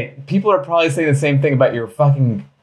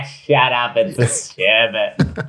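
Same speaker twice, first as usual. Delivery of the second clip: hammed-up Boston dude; badly faked Boston accent, drawn out at the end; much slower than usual. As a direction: play a Boston guy dismissing a correction. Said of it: "I can't do a Boston accent".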